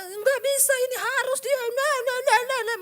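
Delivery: high, loud mocking whine. Preacher mocking a stubborn churchgoer, high whiny voice. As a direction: mimic a stubborn person whining.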